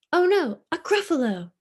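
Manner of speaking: high, loud alarmed cry; fox crying out. Acted. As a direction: shriek it like a startled fox. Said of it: "cried the fox".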